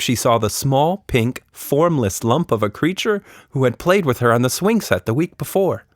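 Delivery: ordinary delivery, nothing marked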